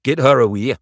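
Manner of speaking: loud, rough order; rough woman's voice. A narrator's reading. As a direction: call it out roughly. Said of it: "The rough voice of the woman".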